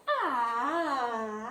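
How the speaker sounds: usual speaking voice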